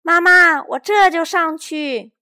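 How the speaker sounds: high, loud, slow joyful reply; boy answering his mother loudly; loud happy answer. A narrator's reading. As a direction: call up to mom gladly. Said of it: "大声回答".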